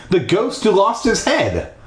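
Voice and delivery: high-pitched, insistent objection; protesting. Acted. Stephanie reminding him of the ghost, high protest. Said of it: "Stephanie protested".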